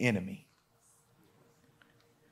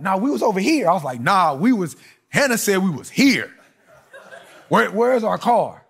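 rising panic; 6 semitones higher, 5 dB louder than usual. Retold or imitated. Lost car at Disneyland, frustrated.

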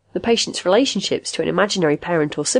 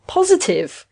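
insistent; 12 semitones higher, slower than usual. A narrator's reading. Patient insists to doubting machine.

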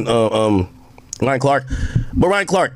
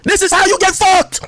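loud, higher and indignant; angry outburst; 8 semitones higher, 3 dB louder than usual. Real, not acted. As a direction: snap back in outrage. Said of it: "Why you so triggered".

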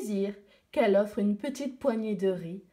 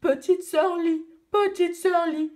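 higher, louder bold panda voice; 6 semitones higher, 4 dB louder than usual. Acted. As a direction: call out like a brave panda. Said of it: "le panda surgit".

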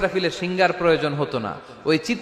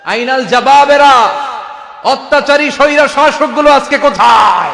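booming call; 8 semitones higher, 8 dB louder than usual. Acted. Preacher voicing God asking where the tyrants are, booming call. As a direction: demand an answer from the empty world.

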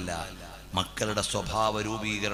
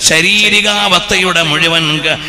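preaching; 6 semitones higher, 19 dB louder, slower than usual. Acted. Sermon chanted at full force.

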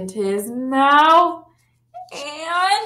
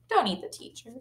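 soft, quick repeat; quiet aside; 9 dB quieter, much faster than usual. Shown as a demonstration. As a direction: drop to a soft, offhand aside.

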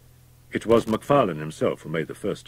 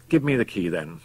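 ordinary delivery, nothing marked

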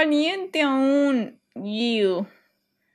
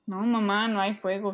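ordinary talk

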